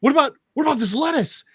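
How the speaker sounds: high, agitated mock demand; manic interrogation. Maniac grilling his captive on lettuce, high manic demand. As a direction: fire questions high, as a maniac.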